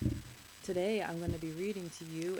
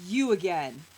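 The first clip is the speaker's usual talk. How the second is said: loud, exasperated exclamation; annoyed old man; 2 semitones lower, 11 dB louder than usual. A narrator's reading. Old man seeing the boy again, annoyed. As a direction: blurt it out, thoroughly fed up.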